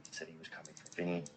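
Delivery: hushed, fast whisper of the threat; dramatic whisper. Acted. Narrator warns viewers about the threat to Vinny's wife, whispering. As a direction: whisper it like a dark secret.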